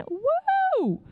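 high-pitched, drawn-out woo; excited whoop. Retold or imitated. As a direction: whoop it up, showing off. Imagines showing off in the car.

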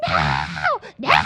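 mock child scream of refusal; imitating a little girl screaming. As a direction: yell no like a panicked toddler.

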